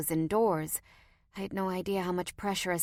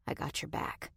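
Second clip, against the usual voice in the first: soft unvoiced whisper; quiet reassurance; 11 dB quieter than usual. A narrator's reading. Roy whispers reassurance to the narrator, gun in lap. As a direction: breathe it low and steady.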